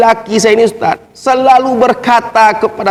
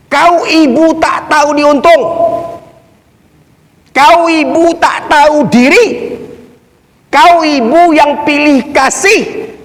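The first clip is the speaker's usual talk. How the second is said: acted angry son; 5 semitones higher than usual. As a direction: scold her as the defiant son, drawing out the words. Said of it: "Apa yang dikatakannya kepada ibunya". (menirukan anak yang memaki ibunya)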